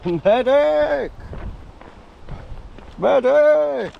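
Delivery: high mock cry for a medic; calling for a medic. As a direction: shout for help like a wounded soldier. Mock call for help in brambles.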